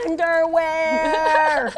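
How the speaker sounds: very slow, high, stretched out; drawn-out reveal. Acted. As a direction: stretch the word for the punchline. Hedgehog's big reveal.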